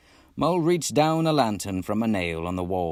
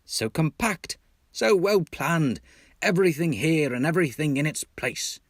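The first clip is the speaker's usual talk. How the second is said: high, bouncing enthusiasm; delighted host voice; cheery; 7 semitones higher than usual. Acted. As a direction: talk the place up brightly. Rat cheers Mole up.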